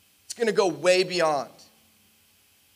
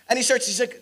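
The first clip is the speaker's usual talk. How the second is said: usual speaking voice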